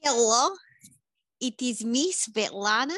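deadpan put-on Eastern European accent; Svetlana accent. Svetlana greets the podcast hosts in a thick put-on accent. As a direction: play Svetlana, blunt foreign guest.

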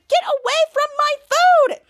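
high, loud outraged screech; angry yell. Acted. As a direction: shriek at them to back off. Piper yells at dogs eating food.

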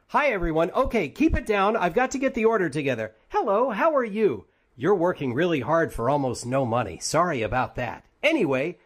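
high, loud, eager robot chatter; upbeat robot voice ordering fast food; Pathfinder. Acted. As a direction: play the cheery robot at the drive-thru window. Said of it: "Pathfinder is driving".

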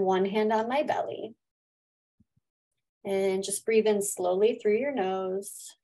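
gentle guided breathing voice. Acted. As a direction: lead them in calmly. Leads a gratitude meditation.

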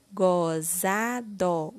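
GOZADO drawn out slowly as go, za, do; syllable by syllable. Shown as a demonstration. Teacher completing the word with syllable gó, slowly syllable by syllable. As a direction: sound out GOZADO one syllable at a time. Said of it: "Iremos utilizar a sílaba gó".

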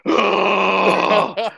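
sudden high scream of fright; startled scream. Retold or imitated. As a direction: let out a sharp scared "Ah!". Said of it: "And then I just went".

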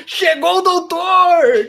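loud, high, drawn-out fake gringo accent; Doutor Rey fake gringo accent. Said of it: "Com aquele sotaque fake de gringo dele".

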